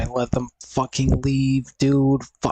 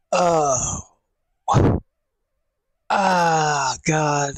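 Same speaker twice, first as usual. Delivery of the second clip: pained cry; 3 semitones higher, much slower than usual. Real, not acted. Back pain, sword in back.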